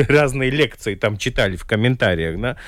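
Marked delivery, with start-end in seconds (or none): none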